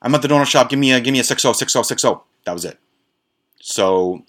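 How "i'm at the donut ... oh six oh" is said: cramming it all into the gap, loud and high; fast loud blurt into a collect call; rushed loud blurt. Retold or imitated. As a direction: squeeze the message into the two seconds. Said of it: "Tone would say".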